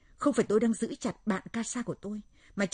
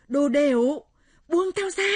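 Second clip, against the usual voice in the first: high, furious yell; very loud, enraged shriek rising higher; panicked shriek; 8 semitones higher, 11 dB louder, slower than usual. Acted. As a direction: scream to be let go. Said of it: "miệng kêu thất thanh".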